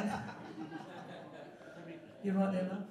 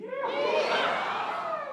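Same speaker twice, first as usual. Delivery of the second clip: very high, drawn-out whoop; loud yeehaw. Real, not acted. Singer leads the crowd after counting three, whooped yeehaw. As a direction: let out a long, high "Yeehaw!". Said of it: "let's make some loud, loud noise".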